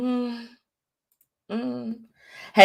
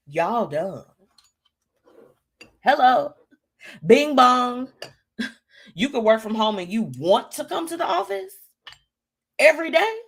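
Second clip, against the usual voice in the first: sassy mock scolding; 7 semitones higher, 3 dB louder than usual. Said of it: "I wanna tell them".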